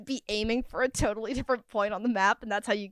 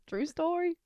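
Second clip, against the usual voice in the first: softer echo; 4 semitones higher, 8 dB quieter, slower than usual. Real, not acted. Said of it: "repeated aside".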